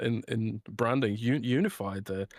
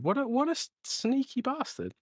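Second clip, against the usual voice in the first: mock indignation; 12 semitones higher than usual. Real, not acted. Reacting to co-host leaving.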